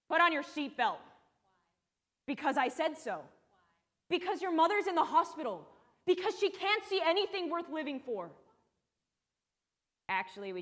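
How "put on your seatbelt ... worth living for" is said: raised, higher, forceful father's voice; exasperated father. Acted. Father answering his seven-year-old's repeated "why", exasperated. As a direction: build from a firm order to an upset outburst. Acting her father snapping at a child.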